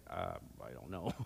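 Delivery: low, soft, sheepish mumble of a husband who has no idea; clueless shrug. Retold or imitated. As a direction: mumble it like you truly don't know. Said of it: "And they're like".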